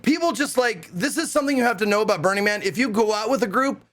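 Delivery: normal talking voice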